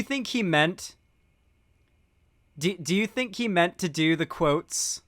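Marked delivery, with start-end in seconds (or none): none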